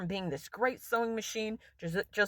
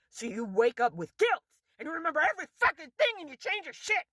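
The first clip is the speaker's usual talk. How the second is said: ranting curse; 7 semitones higher than usual. Real, not acted. Cursing company bosses who wreck their products, a climbing furious rant. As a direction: pitch climbing higher as the curse builds. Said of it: "That's what I curse".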